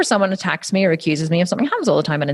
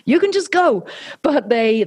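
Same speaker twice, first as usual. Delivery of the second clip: ordinary talk, nothing marked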